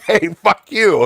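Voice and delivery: high, drawn-out playful retort; mock spat. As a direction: throw a joking insult at your spouse. Acted mock couple's spat.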